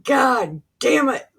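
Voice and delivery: high, loud hammed-up "God damn it"; mock exasperated cry. Frustrated cry acted.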